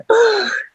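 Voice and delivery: sharp high gasp, mocking a scandalized fan; mock shocked gasp. Mocking fans shocked at her cursing, mock gasp. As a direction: gasp like a prudish listener clutching pearls. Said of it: "those people like".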